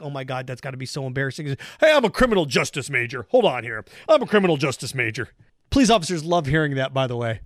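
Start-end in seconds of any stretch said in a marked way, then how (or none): 0.0-1.5 low, soft embarrassed aside; hushed cringe. Retold or imitated. Remembering an embarrassing college friend, soft low cringe. 1.8-5.3 lower, lightly voiced cocky student; mock know-it-all. Mocking a criminal justice major at a busted party, lightly smug.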